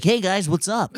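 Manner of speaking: high, bright surfer-style greeting; landlord's American voice. Retold or imitated. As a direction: imitate him saying hey guys like a chill American. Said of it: "but he's like".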